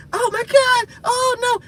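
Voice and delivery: very high mock wail of the lamenting women; hammed-up high lament; wailing enemies. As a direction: shriek it like despairing women. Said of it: "We wanna hear them".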